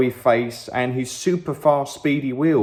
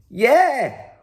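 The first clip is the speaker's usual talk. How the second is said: excited scream; 9 semitones higher than usual. A narrator's reading. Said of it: "I screamed".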